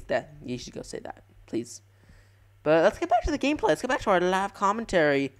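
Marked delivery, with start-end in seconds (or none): none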